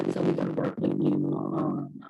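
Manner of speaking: garbled robot-like connection; choppy digital distortion on the voice; robotic glitchy audio. Real, not acted. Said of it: "you started sounding like a robot".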